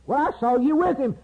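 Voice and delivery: high-pitched acted accuser; preacher acting a nosy accuser; insistent accusation. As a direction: point the finger, voice held high. Bystander accuses Peter.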